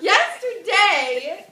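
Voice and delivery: loud, high, drawn-out exclamation; mock-incredulous outburst. Hamlet answering about his father, mock-incredulous outburst. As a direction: blurt it slowly and loudly, rising.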